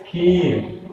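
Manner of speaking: खीर with the ī held long; long vowel. Shown as a demonstration. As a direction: linger unhurriedly on the vowel of खीर. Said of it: "ठहर के आराम से पढ़ते हैं".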